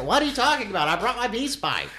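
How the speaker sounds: high, puzzled mock voice; confused doctor. The doctor answering the screaming family, mock bewildered. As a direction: play him lightly clueless.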